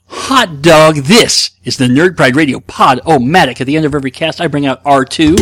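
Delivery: loud, high show-host intro; revved-up podcast segment host; radio host hype. Acted. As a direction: sell the segment like a radio jingle.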